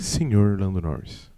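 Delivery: low, slow velvety tone. Pretend. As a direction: purr the name softly. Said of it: "Agora falem com a voz aveludada".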